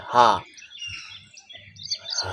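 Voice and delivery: breathy हा pushed from the chest; vocal fry ha. Shown as a demonstration. Coach showing the vocal fry exercise, a chest ha. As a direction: let हा out on a long breath. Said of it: "हा की आवाज़ निकालनी है".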